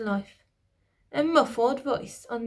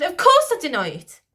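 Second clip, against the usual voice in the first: high, loud indignant denial; queen denying; 8 semitones higher, 9 dB louder than usual. A narrator's reading. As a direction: deny it hotly. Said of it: "the lady stuttered".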